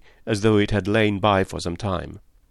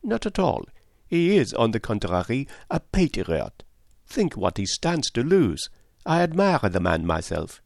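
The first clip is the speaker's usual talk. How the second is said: lightly voiced Poirot; 2 semitones higher than usual. Poirot defending the spy as a patriot, detective voice. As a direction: contradict him as an admiring detective.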